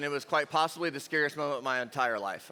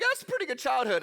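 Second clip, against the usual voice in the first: higher-pitched mock reflection; pleased inner voice; 7 semitones higher than usual. Teen watching life flash before eyes, high pleased voice. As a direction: pipe it up, pleasantly impressed.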